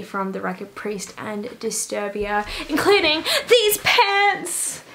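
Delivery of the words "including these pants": playful. Real, not acted. Slow, high showing off pants.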